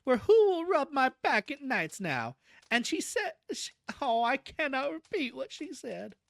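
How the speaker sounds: high, self-pitying lament; pathetic. A narrator's reading. Cousin unable to repeat shocking words, pathetic. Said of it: "said Cousin Stickles pathetically".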